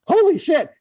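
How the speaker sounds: high, astonished exclamation; starstruck amazement. Retold or imitated. Retold starstruck reaction to meeting Paul.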